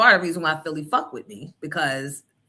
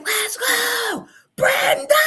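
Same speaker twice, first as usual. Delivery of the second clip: high, loud cheering yell; yelling to the son, high and loud; 5 dB louder, much slower than usual. Retold or imitated. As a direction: shout it through the house. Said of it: "I started yelling".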